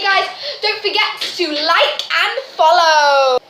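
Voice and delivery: high, loud vlogger sign-off; bright and pumped for viewers; excited outro. Acted. Hyped vlog outro.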